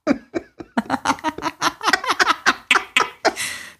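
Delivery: high burst of laughter; long laugh. Real, not acted. Laughing at the insult.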